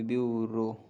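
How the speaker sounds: usual speaking voice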